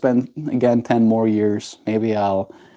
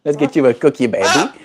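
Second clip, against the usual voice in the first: normal talking voice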